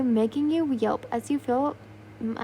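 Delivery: usual speaking voice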